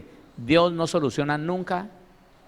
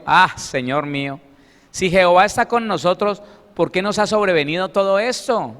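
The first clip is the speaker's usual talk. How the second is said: rising, louder reproachful question to God; aggrieved complaint; 3 semitones higher, 5 dB louder than usual. Acted. Gideon complaining to the Lord, aggrieved and louder. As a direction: plead with God as if wronged. Gedeon's complaint read again.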